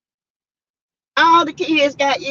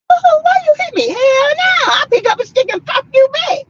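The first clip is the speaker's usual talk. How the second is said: high-pitched, hammed-up outrage; mock-outraged squeal, loud and high; mock indignation; 4 dB louder than usual. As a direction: yell it high like a play fight. Re-enacts fighting back when hit.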